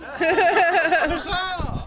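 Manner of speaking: loud, high drawn-out huzzah; hearty showman's cry; rallying cheer. Acted. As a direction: lead the crowd in a big cheer. Showman leads crowd in cheer.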